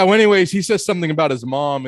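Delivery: ordinary talk, nothing marked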